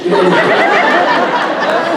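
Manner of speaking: bursting into high laughter; real laughter. Real, not acted. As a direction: let a real laugh break out. Laughs at fans' demand.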